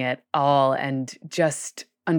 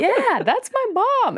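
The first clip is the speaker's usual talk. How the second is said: put-on high, slow proud little boy voice; imitating a small child. Three-year-old boy about his mom on stage, high and proud. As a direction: play a toddler proudly pointing at mom. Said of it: "And he was like".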